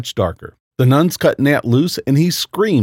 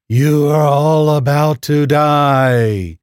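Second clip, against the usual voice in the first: slow, menacing robotic voice; possessed nun, cold robot voice; robotic demon voice; 2 semitones higher, 4 dB louder, much slower than usual. Acted. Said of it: "with a nefarious robotic voice".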